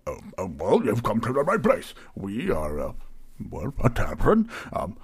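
stammering, eager host; bumbling innkeeper, pitch bouncing; flustered tavern keeper. Acted. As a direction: fuss and backtrack while welcoming a customer. Plays Barry, flustered tavern keeper.

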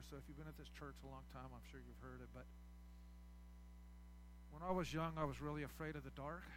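normal talking voice

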